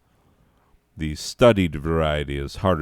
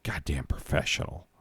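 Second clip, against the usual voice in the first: whisper; 3 semitones lower, 9 dB quieter than usual. Real, not acted. Muttering to himself after reading.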